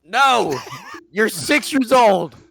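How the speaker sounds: exasperated parental telling-off; scolding dad. Acted. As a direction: exclaim it like an appalled dad. Acted father scolds his child.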